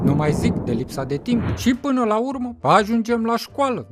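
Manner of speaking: ordinary delivery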